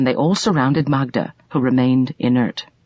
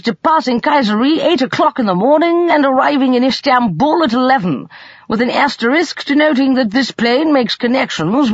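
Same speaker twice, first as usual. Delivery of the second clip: high-pitched, eager timetable recital; animated uncle; 10 semitones higher, 4 dB louder than usual. Acted. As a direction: rattle off the schedule with keen enthusiasm.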